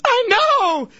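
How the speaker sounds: very high imitation wail; hammed-up tearful squeal; mock bawling. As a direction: imitate a woman bursting into tears. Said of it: "And starts bawling".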